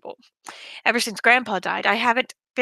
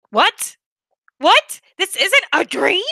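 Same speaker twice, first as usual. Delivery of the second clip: much higher, louder disbelieving cries; shocked realization; 8 semitones higher, 6 dB louder, slower than usual. Acted. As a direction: jolt awake in shock.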